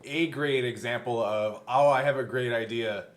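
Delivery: ordinary talk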